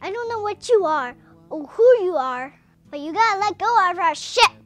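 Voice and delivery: loud, angry captain's order; angry demand. Retold or imitated. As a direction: bark it at the intruder, fed up. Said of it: "Captain Kirk gets mad".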